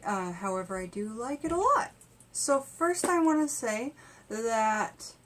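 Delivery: ordinary delivery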